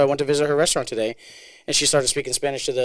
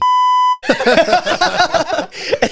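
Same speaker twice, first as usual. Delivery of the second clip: loud, high-pitched cackling laugh; burst of laughter; 10 dB louder than usual. Real, not acted. Host slips and drops a friend's name, bursts out laughing.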